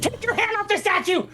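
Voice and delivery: high-pitched urgent cry; high startled command; alarmed shout. Acted. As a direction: shout the order in sudden alarm.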